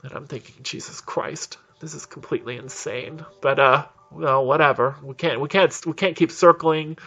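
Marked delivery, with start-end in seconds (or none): 0.6-3.2 muttered disbelief. Retold or imitated. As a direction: mutter it under your breath, aghast. Muttered thought of alarmed passenger.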